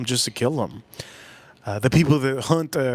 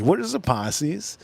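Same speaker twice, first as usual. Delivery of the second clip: slow, odd put-on voice; mock question; 3 semitones higher, slower than usual. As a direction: mimic a puzzled viewer, slowly. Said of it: "mock question from viewers".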